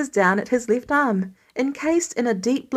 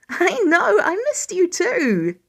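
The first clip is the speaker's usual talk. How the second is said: doting voice; 7 semitones higher than usual. Acted. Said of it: "squeals with joy".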